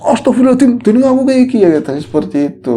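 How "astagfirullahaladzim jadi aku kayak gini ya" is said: higher and louder, a stunned exclamation; shocked realization. Retold or imitated. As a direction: gasp it out as the truth hits. Ghost realizes its true state. (hantu kaget menyadari keadaannya, berseru lebih keras)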